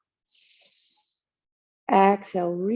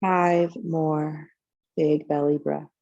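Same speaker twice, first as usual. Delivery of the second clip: unhurried, calm yoga teacher leading relaxation; guided meditation. Real, not acted. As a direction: guide them gently into the breath, phrase by phrase. Leads a guided breathing meditation.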